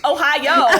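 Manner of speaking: Japanese greeting in a mock Southern twang; Southern-accented Japanese. Co-host mimics a TikTok woman's bits, Southern-accented Japanese. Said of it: "speaking Japanese in a Southern accent".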